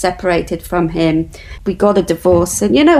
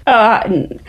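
normal talking voice

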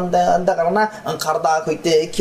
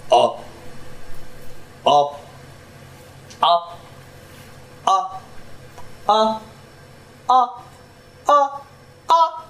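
rising vocal scale; slower than usual. Shown as a demonstration. Tuning voice up a scale.

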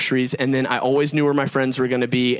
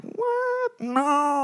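high, soft squeak of disbelief; mock disbelief; 6 dB quieter than usual. Realizing graduation was years ago, high hushed disbelief. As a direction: gasp it out in shocked denial. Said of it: "I'm like".

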